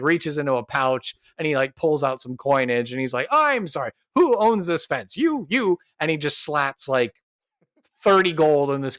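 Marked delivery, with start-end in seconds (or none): 3.3-5.8 high, hasty acted appeasement; placating apology. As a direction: hunt for whom to pay off. Duncan offers money to upset townspeople.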